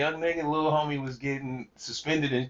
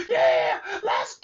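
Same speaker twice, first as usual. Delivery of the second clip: excited shout; 5 dB louder, much slower than usual. Retold or imitated. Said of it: "He like".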